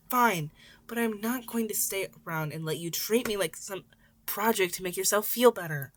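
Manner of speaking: put-on teen yelling at his mentor; angry outburst. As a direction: lash out to push him away. Izuku storms up yelling.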